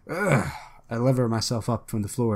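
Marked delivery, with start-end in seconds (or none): 0.1-0.5 short acted grunt of effort; raised effortful groan. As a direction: grunt as you heave yourself up. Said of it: "And with a grunt".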